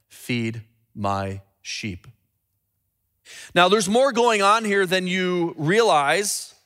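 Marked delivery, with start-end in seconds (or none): 0.1-2.1 low, slow and soft, drawn out; hushed and reverent, much lower; solemn, hushed Jesus. Acted. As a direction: speak it gently as the Lord's command. Jesus's words read solemnly.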